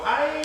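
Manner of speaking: high yelp of Ai; mock Brazilian yell. Mocking how Brazilians yell in training, high yelp. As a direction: imitate a Brazilian's pained yell. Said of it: "how people in Brazil, they yell".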